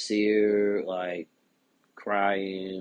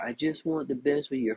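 a touch higher, lightly voiced sweet damsel; mock-sweet apologetic woman; mock damsel in distress; 4 semitones higher than usual. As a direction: imitate her gentle apology, only lightly. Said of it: "damsel in distress, feminine energy".

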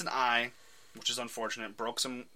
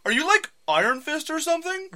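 high curious onlooker; 7 dB louder than usual. Acted. Vet onlooker asks Clint.

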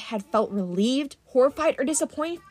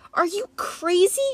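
high, slow, incredulous question; she's just been doused and can't believe it; high astonished Talia; startled disbelief; 9 semitones higher than usual. Acted. Talia snaps out of daze.

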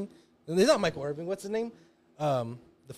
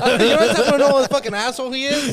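laughing through it; 9 semitones higher, 10 dB louder than usual. Real, not acted. Laughing while repeating the rant.

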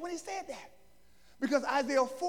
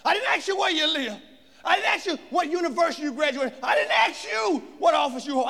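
loud, higher, insistent interrogation; demanding shout; 7 semitones higher, 7 dB louder than usual. Acted. Priests shouting at John to say who he is. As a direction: press him hard for an answer.

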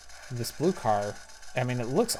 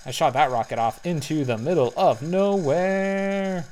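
louder, slow amused exclaiming; excited ooh; 5 semitones higher, 6 dB louder, slower than usual. Real, not acted. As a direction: call out the wild miss. Laughing at missed rocket.

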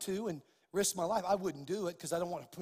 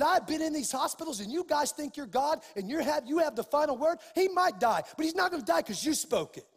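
loud, higher preacher's declamation; impassioned preaching; 5 semitones higher, 6 dB louder than usual. Real, not acted. Preacher retelling his rebuke of a doctor, impassioned preaching. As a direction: thunder it out, building to a triumphant declaration.

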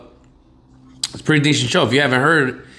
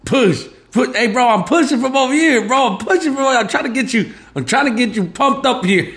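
much higher, pumped-up imitation of a buddy doing CPR; hyped CPR buddy; 11 semitones higher than usual. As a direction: cheer him on like a hammed-up coach, high and eager. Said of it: "You'll be on the other end and be like".

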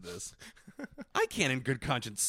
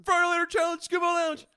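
much higher, louder hype call; high shouted slogan; hype announcer; 5 dB louder than usual. Acted. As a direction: announce it like a stunt show. Hyped challenge announcement.

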